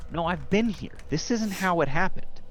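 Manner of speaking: high, urgent denial; startled inner cry. Acted. Character realizing the vision is false, pitched high. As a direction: break from the memory in disbelief.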